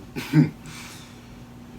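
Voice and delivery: brief chuckle, mostly breath; short amused laugh. Acted. Performer comparing himself with his brother, short amused laugh.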